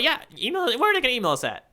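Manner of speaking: excited email plug. Real, not acted. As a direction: pitch way up, selling it.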